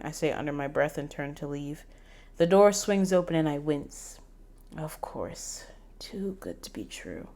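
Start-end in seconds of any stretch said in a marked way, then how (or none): 4.8-7.3 resigned whisper to herself; soft unvoiced whisper of defeat; whispered aside. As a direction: whisper "Too good to be true." under the breath.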